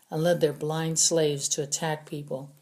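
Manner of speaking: ordinary delivery, nothing marked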